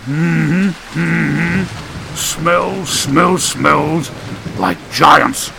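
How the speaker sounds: halting, grunting character voice; hum, then sniff out each word grimly; grim muttering. A narrator's reading. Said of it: "With a grim look, he muttered".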